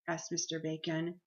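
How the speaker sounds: plain reading voice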